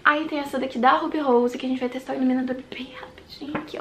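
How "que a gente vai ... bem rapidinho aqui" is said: fast breathy ASMR whisper; quick unvoiced whisper to the mic. Shown as a demonstration. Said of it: "eu tô viciada em A S M R".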